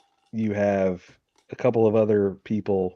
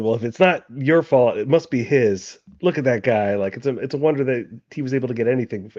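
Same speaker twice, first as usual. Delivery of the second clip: higher, louder incredulous accusation; exasperated blame; 5 semitones higher, 6 dB louder than usual. Acted. Marquis blaming the jittery coffee drinker, exasperated. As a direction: turn the blame on him, voice raised. Acted blame turned on teammate.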